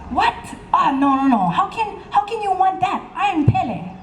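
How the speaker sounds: high, indignant goddess ranting; jealous outrage. Acted. Pele's jealous outburst.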